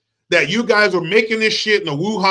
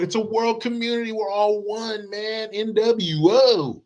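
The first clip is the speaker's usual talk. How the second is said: mock hippie globalist; 3 semitones higher, 6 dB quieter than usual. Mocking globalist talk.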